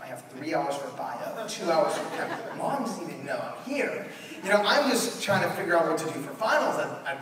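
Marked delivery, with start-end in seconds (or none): none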